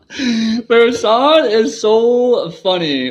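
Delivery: high, slow, laughing praise; laughing delight. Real, not acted. Amused by Versan's joke, laughing high and slow. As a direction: still cracking up as you say it.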